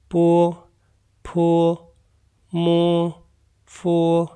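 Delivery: "पो, फो, मो, फो" as separate clear syllables with pauses; Chinese initials drill. Shown as a demonstration. Teacher models "पो, फो, मो, फो" for students to repeat. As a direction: model "पो" and "फो" for learners to copy. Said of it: "दोबारा से पढ़ते हैं".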